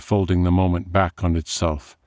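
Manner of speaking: ordinary delivery, nothing marked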